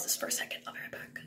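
soft whisper. Real, not acted. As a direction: whisper it quickly. Pausing recording, whispering.

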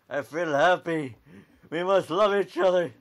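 hammed-up lovey-dovey cheer; giddy lovestruck. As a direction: gush like a lovestruck cartoon. Love liquid makes him giddy.

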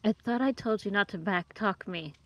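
ordinary talk, nothing marked

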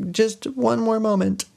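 high-pitched mock plea; sing-out stalling reply; pleading wife. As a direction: beg for time in a high voice.

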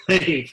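bright real laugh then agreeing. Real, not acted. Laughing at YouTube joke.

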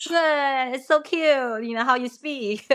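high, sing-song mock praise; patronizing coo. Friend mimics locals cooing over broken Chinese, high patronizing tone. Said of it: "and like".

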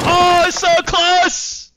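long high-pitched cry of shock; shocked high yell. Real, not acted. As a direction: yell it out, slow and stretched. Near miss, grenade explodes.

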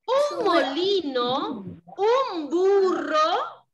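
each item drawn out slowly; slow listing for children. Shown as a demonstration. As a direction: spell out the list so the kids can follow. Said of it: "¿Cuáles eran?".

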